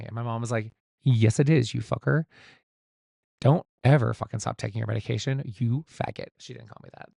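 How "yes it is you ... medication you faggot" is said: loud, emphatic imitation of a telling-off; mock scolding mom. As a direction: scold like an exasperated mom, hammed up. Mock-scolding mom in retold quote.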